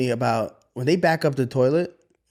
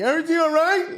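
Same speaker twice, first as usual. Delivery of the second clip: put-on much higher kid voice; imitating his kids; 14 semitones higher than usual. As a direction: innocent little check-in. Voicing kids behind him.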